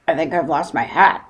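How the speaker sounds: puzzled boatman voice, rising end; boatman repeating. Acted. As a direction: echo the bear, puzzled. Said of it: "repeated the man".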